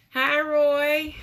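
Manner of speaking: normal talking voice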